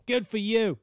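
mock dismissive accent, half British; New York accent slipping British. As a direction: mimic a New Yorker, drifting toward British. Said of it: "It's kinda started to sound British".

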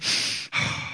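imitated labor exhale; labor breathing. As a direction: blow out a breath like a woman in labor. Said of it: "she's in the car breathing".

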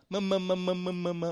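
lightly imitated baby babble Mama; baby calling Mama, lightly done; baby babbling. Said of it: "sagt die immer". (Baby plappert leicht angedeutet Mama)

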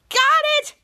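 very high, loud triumphant squeal; gloating. Retold or imitated. Piper to Henry after the scare, gloating. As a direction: crow like a winning prankster.

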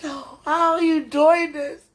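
usual speaking voice

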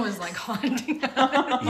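loud, slow, laughing words. Real, not acted. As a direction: say it while laughing. Laughing at ghost idea.

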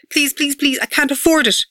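high-pitched desperate begging; pleading. A narrator's reading. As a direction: plead urgently to be let off. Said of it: "I pleaded".

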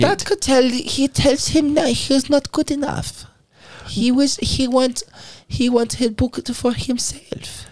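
high, slow put-on German accent fading to a whisper; a hint of a scheming German publisher. Cohost tries the accent again, trailing into a whisper. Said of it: "That was better".

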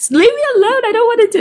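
high, loud bratty whine; whiny teenager. Retold or imitated. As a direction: whine it like a sulky teen. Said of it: "sounding like a whiny teenager".

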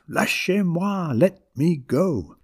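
high, drawn-out demand, lightly screamed; mock scream. A narrator's reading. Grandmother pinned by grandchildren, high drawn-out mock scream. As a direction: stretch it out high in protest. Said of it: "continued to scream at us".

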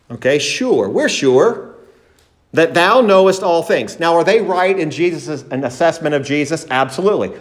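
ordinary talk, nothing marked

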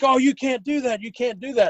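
protesting crowd. Retold or imitated. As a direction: imitate people objecting, voice lifted. Protesting people voiced in a story.

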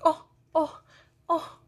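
put-on high little toddler voice, fretting; toddler cooing. Grandma imitating toddler fretting over crying baby brother, high and childlike. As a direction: voice a worried little boy, pitched high.

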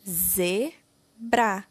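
ZEBRA split into ze, bra; syllable by syllable. Shown as a demonstration. Teacher giving the name of the figure, syllable by syllable. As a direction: sound out ZEBRA with a gap between its syllables. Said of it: "escreva o nome da figura".

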